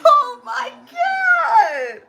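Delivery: real high-pitched disbelief, stretching out the exclamation; shocked outburst. Real, not acted. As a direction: cry out, aghast at the cut. Shocked reaction to documentary cutting to commercial.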